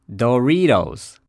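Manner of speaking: ドリトス slowly as American "duh-REE-dohs"; 英語発音. Shown as a demonstration. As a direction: stretch and stress the REE in ドリトス for learners to repeat. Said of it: "と発音します". (ドリトスを「ドゥリードウズ」とゆっくり英語式に)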